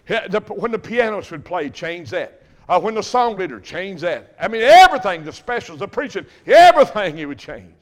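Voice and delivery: loud, ringing pulpit preaching; preaching at full force. Acted. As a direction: preach it out to the back pews. Preacher building to a climax.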